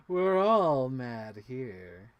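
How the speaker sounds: lightly voiced grinning cat, slow and drawn out; a hint of the Cheshire Cat purring its line slowly. To her Cheshire Cat figure, a lightly voiced, slow cat. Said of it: "Cheshire Cat".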